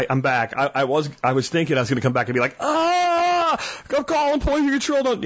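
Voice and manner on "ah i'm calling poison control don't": hammed-up, very high strangled cry; high, fake gagging alarm; mock poisoning panic. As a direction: wail as if pretending to be poisoned. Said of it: "like, gagging or whatever".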